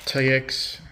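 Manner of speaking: usual speaking voice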